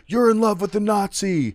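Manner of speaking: high, loud heckle from the crowd; shouting heckler. Retold or imitated. Heckler in the crowd shouting at the stage, loud. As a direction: yell it out from the back of the crowd. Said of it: "A guy in the crowd actually shouts".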